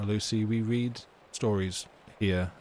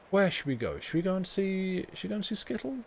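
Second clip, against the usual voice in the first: much higher, playful sing-song question; teasing viewers with a high put-on voice; silly high voice; 11 semitones higher than usual. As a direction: squeak the suggestion like a cartoon. Playful silly voice, raid choice.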